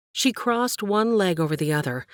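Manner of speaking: ordinary delivery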